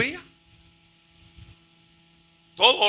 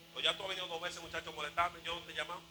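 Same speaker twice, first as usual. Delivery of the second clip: very soft, low-energy reply; muted weary grumble; 3 semitones lower, 13 dB quieter, faster than usual. Acted. Old priest sends the bothering boy away, very softly. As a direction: grumble it under your breath. Sleepy Eli answers the boy.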